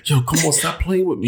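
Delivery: high-pitched pleading reenactment; replayed exasperation, pitch up; retold exasperated plea. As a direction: plead it high, half in panic.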